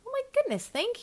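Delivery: high, thrilled exclamation; delighted gasp. Real, not acted. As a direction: gush with surprise. Touched by listener praise.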